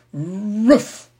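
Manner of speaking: high, loud dog yelp; mock dog wail up at the sky; wailing puppy bark. A narrator's reading. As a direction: howl it like a silly puppy. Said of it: "wailed Prince Terrian".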